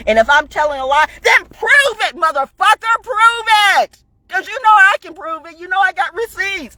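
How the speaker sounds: fired-up, high-pitched holler. Real, not acted. Woman challenging doubters to prove her claims, hollering. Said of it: "I didn't mean to holler".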